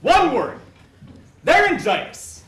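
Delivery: loud eager guessing; excited shouting. Acted. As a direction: shout out the answers. Lautes Raten bei Scharade.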